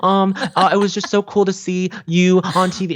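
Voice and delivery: put-on very high, bright eager teen voice; peppy kid voice. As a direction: gush like an excited young fan. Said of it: "bright, peppy kid".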